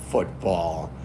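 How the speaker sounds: low, soft, laughing football; quiet amused Football. Real, not acted. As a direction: chuckle the word Football under your breath. Joking about the word football.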